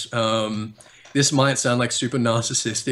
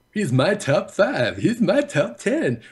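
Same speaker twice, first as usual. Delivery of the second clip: slow, rising hammed-up clickbait host; mocking clickbait YouTuber; 9 semitones higher, slower than usual. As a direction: drag out each list like a cheesy YouTuber. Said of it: "I try to avoid, like".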